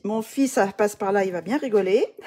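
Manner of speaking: loud, laughing self-mockery; amused self-mockery. Real, not acted. Vlogger imagining their son hearing this accent, loudly amused. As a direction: laugh at yourself as you talk.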